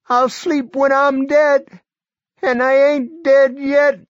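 put-on high, loud, strained old man; a feverish old man pushing through; sick old man. A narrator's reading. Barry defiant.

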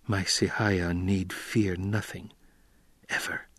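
slow, soft, tender whisper. A narrator's reading. Paul comforting tearful Chani, whispering. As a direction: hush it down, reassuring her slowly. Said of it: "he whispered".

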